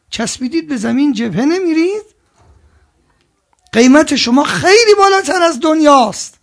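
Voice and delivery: high, loud reproach building up; rising rebuke. Real, not acted. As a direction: call them out, pitch climbing. Preacher rebukes the faithful.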